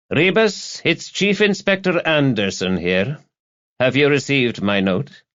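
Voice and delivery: high-pitched superior officer on the phone; brisk chief inspector Anderson. Acted. Chief Inspector phoning his sergeant, officious. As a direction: announce yourself importantly, pitched high.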